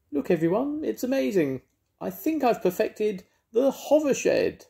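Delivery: a mouse showing off his invention; excited Mouse. Acted. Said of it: "cried Mouse".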